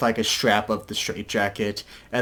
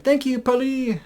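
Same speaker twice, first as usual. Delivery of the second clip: mock [name]. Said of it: "He's like".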